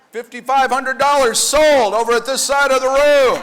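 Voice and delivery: booming auctioneer close; loud sold call. Acted. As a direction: announce the sale loudly. Declaring the lot sold.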